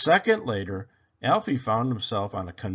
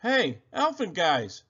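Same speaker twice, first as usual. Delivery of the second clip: called out; 7 semitones higher than usual. Acted. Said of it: "Alfie called".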